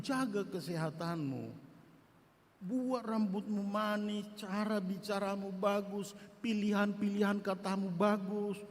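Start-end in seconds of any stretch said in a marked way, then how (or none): none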